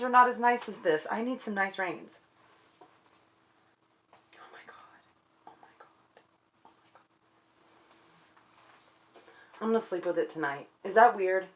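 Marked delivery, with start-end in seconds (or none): 4.3-9.2 breathless, barely voiced whisper; hushed real awe; awed whisper. Real, not acted. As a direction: whisper it in disbelief. Awed by the new bridle.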